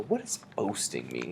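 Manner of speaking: soft, pondering question; thinking voice. Shown as a demonstration. Reader models thinking about an unfamiliar word, softly pondering. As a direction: wonder it quietly, as if thinking aloud. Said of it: "I'm gonna use my thinking voice".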